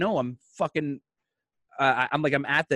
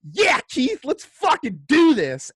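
much higher and louder, pumped-up yell; fired up; 12 semitones higher, 7 dB louder, slower than usual. Retold or imitated. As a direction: shout it like you're ready for war. Fired up, ready for war.

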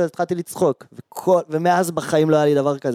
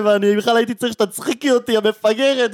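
exasperated mock yell; 8 semitones higher than usual. Replaying how he yelled at the girl, exasperated and raised. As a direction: vent at her as if she's right there. Retold shouting at girlfriend.

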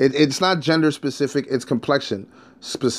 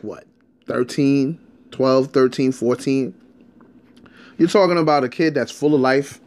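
usual speaking voice

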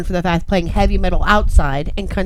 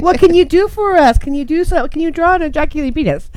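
high and louder, eager mock request; hammed-up pleading; 9 semitones higher, 4 dB louder than usual. Asking a tattoo artist for a silly design, high excited mock plea. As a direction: beg the tattoo artist playfully, high and loud.

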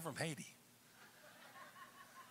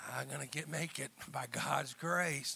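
downbeat resignation; 3 semitones lower, 7 dB quieter than usual. Acted. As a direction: mimic someone barely getting by. Acting a half-hearted believer's answer.

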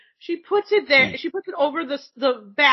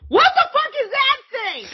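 high-pitched shocked outcry; comic freak-out, pitched way up; alarmed retold yell; 12 semitones higher, 4 dB louder than usual. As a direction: yelp it in horrified surprise. Retells shock at crawling creature.

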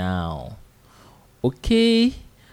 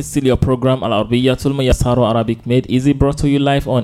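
louder, upbeat host welcome back; radio announcer; 6 dB louder than usual. Acted. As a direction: open the show like a live radio host. Radio host reopens after break.

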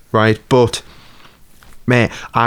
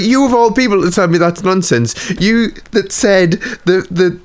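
higher, slow, incredulous comeback; heated retort; 7 semitones higher than usual. Retold or imitated. To a coworker who scoffed, a higher incredulous retort. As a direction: scoff back at a coworker in disbelief.

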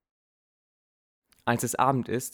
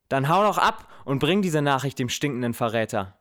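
loud, higher angry yell; shouted after him; 5 semitones higher, 6 dB louder than usual. Acted. Father shouts after his fleeing son, angry. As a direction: hurl it after him down the hall. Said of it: "schreit mir mein Vater hinterher".

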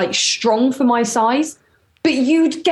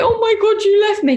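mock tearful; 8 semitones higher than usual.

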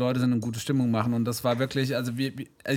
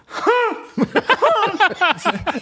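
loud open-mouthed sneeze burst, very high; fake sneeze with mouth wide open; 6 dB louder than usual. Shown as a demonstration. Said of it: "ich versuch das mal nachzumachen".

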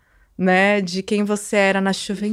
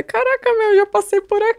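much higher, excited disbelief; like recognising a place in wonder; amazed exclamation; 12 semitones higher than usual. Retold or imitated. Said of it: "Daí eu falei".

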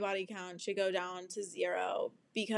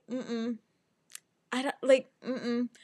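ordinary talk

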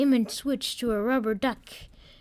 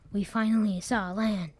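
plain reading voice

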